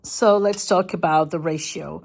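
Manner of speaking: ordinary talk, nothing marked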